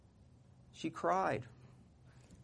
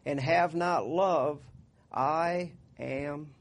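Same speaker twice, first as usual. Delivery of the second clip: raised, slow Bible reading; scripture reading; 3 dB louder, slower than usual. Acted. Pastor reading First Corinthians to the church, slow and raised. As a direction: proclaim the love chapter slowly.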